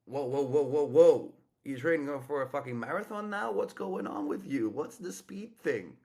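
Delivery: loud, mock-astonished whoas, then playful ribbing; playful excited teasing. Shown as a demonstration. Coach teases a woman walking fast, loud and mock-amazed. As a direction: call out to her like an old buddy, amused.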